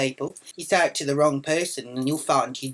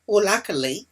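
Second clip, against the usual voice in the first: normal talking voice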